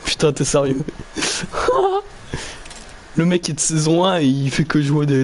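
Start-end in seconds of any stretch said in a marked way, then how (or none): 0.9-2.1 high laugh. Real, not acted. Laugh after outrage.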